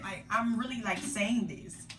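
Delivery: ordinary talk, nothing marked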